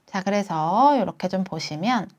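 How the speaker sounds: ordinary talk, nothing marked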